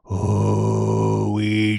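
loud, drawn-out monotone summons; grim call. A narrator's reading. The voice calling the blind man a second time, grim loud summons. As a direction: call the name grimly, holding each vowel. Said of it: "grimly called the voice a second time".

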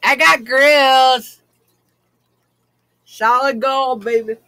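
slow, loud gangster brag; slow boast. Real, not acted. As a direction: show off the gold slowly.

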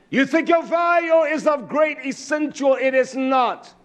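high, insistent sermon cadence; fervent preaching. Real, not acted. Preacher warns listeners that youth and vitality fade, fervent. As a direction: drive each phrase home from the pulpit.

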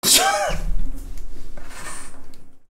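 high airy breathless cackle; silent wheezing laugh. Real, not acted. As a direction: crack up without a sound. Laughing after outburst.

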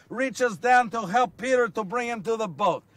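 ordinary talk

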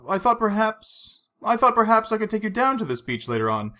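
tentatively offer, restarting after a halt; brave, hesitant suggestion. A narrator's reading. Vesper presses on despite Bond's silence, bravely hesitant. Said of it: "She continued bravely".

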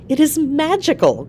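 high-pitched, marvelling exclamation; crying out in wonder. A narrator's reading. Wife amazed at the conjured flower, crying out in wonder. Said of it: "cried Georgina".

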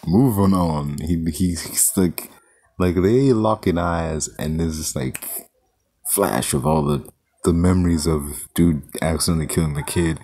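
usual speaking voice

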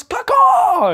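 very high, loud hawk cry; hawk call. Retold or imitated. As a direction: screech it like a hawk. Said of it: "she looks at Jared and she goes".

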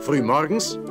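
plain reading voice

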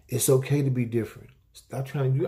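ordinary talk

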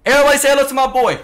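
loud, fast hype greeting; hype shout. Real, not acted. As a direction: hype up the stream. Streamer hyping chat, fast.

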